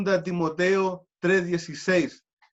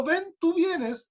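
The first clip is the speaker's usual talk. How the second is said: much higher call of ven; calling the child; 10 semitones higher, slower than usual. Retold or imitated. Calling child, pitch jumps high. (llamando al niño con voz aguda)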